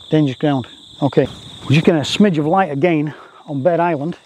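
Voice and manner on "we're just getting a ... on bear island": normal talking voice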